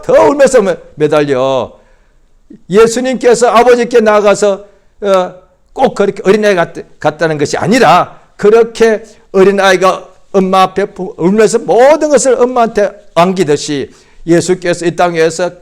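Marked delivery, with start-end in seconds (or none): none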